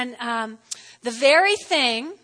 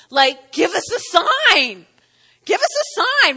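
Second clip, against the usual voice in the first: skeptic's demand; 5 semitones higher, 7 dB louder than usual. Retold or imitated. Voicing the skeptic's challenge.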